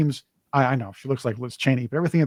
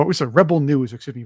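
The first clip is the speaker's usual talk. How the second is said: usual speaking voice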